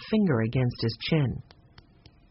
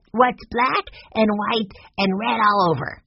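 put-on higher-pitched kid voice; little boy posing riddle; 6 semitones higher than usual. A narrator's reading. Narrator voices Benny posing riddle.

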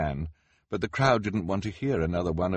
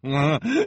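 high, loud villain's chuckle; short menacing laugh in character; 11 semitones higher, 8 dB louder than usual. Acted. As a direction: chuckle knowingly. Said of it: "replied with a chuckle".

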